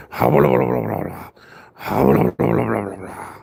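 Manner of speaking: a loud "Ha" then blah blah babble that trails off low; loud mocking taunt. Real, not acted. Taunting his dog with loud blah blah babble. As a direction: taunt the dog like a playground bully.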